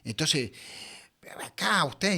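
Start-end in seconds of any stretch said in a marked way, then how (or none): 1.4-2.2 higher mock scolding shout; retold scolding. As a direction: imitate the headmistress barking at a child, half-hearted. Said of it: "y me gritaba".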